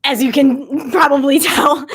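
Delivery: laughing through tears; a shaky little laugh while fighting tears; tearful laugh. Real, not acted. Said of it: "I have not processed that loss fully".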